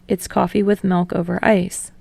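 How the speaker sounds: ordinary delivery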